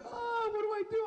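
high-pitched hammed-up wail; mock crying. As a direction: wail helplessly in mock tears. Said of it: "This motherfucker started crying".

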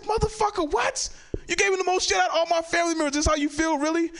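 high, fast indignant squeal; can't believe the hypocrisy, pitch way up; incredulous outrage. Retold or imitated.

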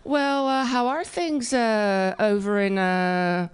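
halting question full of searching pauses; groping hesitation. Retold or imitated. Warehouse guy guessing her homeland, halting and unsure. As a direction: grope for the place name, trailing uhs.

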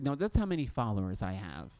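low, deadpan influencer reply; a hint of a smug roommate. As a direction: answer as if it's obvious. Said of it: "She's like".

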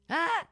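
panicked yelp. Retold or imitated. Panicking over running water.

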